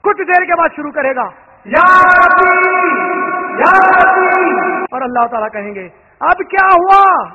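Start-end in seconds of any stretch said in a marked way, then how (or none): 1.6-4.9 loud, slow drawn-out plea to God; pleading cry. Acted. Man at heaven's gate begging God, loud slow cry. 6.2-7.3 loud, slow question from above; weary divine question. Acted. God asking the begging man, slow loud question.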